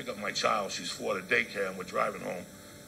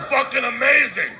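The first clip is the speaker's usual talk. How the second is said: much higher and louder, slowed down; loud slow punchline; 12 semitones higher, 12 dB louder than usual. Acted. Comedian marveling at stepfather to the crowd, loud awed shout. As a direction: belt it out in awe.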